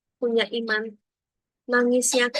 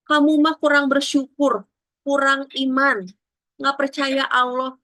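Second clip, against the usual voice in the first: scolding; 5 semitones higher, 5 dB louder than usual. Retold or imitated.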